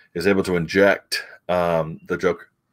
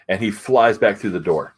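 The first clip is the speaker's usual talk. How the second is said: ordinary talk, nothing marked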